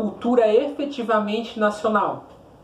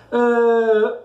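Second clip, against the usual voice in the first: ordinary talk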